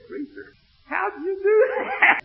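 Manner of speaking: high, loud, laughing disbelief; stunned layman asks the preacher; amazed. Retold or imitated. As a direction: ask it half-laughing in wonder.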